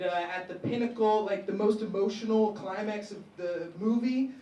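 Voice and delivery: normal talking voice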